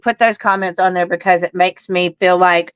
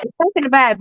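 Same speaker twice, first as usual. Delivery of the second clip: ordinary talk, nothing marked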